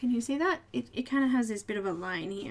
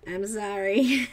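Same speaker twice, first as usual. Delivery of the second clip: laughing; 4 semitones lower, 4 dB louder, slower than usual. Real, not acted. Apologizing while laughing.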